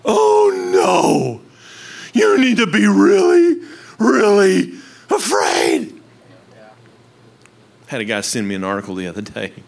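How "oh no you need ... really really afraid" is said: loud, higher hammed-up panic, slowing at the end; over-the-top frightened warning, louder and higher; mock alarmed news. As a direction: cry out like a fearmongering anchor. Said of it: "what you hear is".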